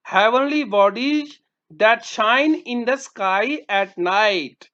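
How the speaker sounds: slow, careful phrase; slow dictation. Shown as a demonstration. Teacher giving a word meaning to pupils, slow. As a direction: read it slowly for children. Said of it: "teacher reading meaning".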